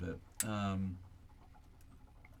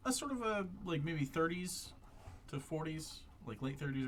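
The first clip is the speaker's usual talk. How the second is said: ordinary talk, nothing marked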